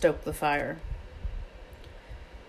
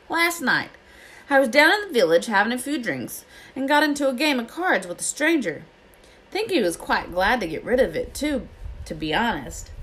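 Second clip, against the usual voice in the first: higher, lilting character voice; Hagrid, pleased; 7 semitones higher than usual. A narrator's reading. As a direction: tell the tale of a lucky win. Hagrid telling his tale.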